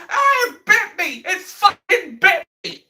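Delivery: very high, slow, whiny mock wail; mock crying. Government after ignoring warnings, mock crying very high and slow. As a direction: whimper it like a hammed-up crybaby. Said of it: "crying like".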